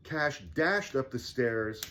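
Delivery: ordinary delivery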